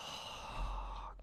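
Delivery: long unvoiced sigh; weary sigh. Real, not acted. Gamer watching his team struggle, weary sigh. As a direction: let out a tired breath. Frustrated at game.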